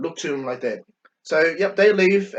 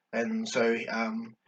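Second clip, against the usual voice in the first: usual speaking voice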